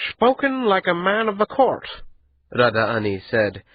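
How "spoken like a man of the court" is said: amused, a smile in the voice; smiling voice. A narrator's reading. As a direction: say it with a knowing smile. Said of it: "the smile in his voice".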